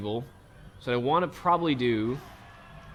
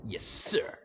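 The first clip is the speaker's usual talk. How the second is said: snide aside; 4 dB quieter than usual. Retold or imitated. Sarcastic reply to commander.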